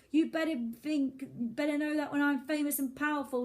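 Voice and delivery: high angry yell. Acted. Lonely boy yells threats at his absent classmates. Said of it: "he yelled".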